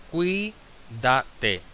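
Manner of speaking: "cuídate" in three separated beats, cuí-da-te; syllable by syllable. Shown as a demonstration. Teacher showing an esdrújula, "cuídate" syllable by syllable. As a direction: break "cuídate" up, leading with a stressed cuí. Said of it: "Como es esdrújula".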